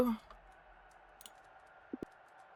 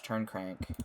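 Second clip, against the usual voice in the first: ordinary talk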